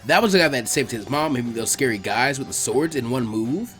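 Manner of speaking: ordinary delivery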